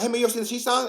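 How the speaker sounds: high, loud, hurried child impression; rapid-fire kid. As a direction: blurt it out quickly like a boy. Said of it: "he said it just fast enough".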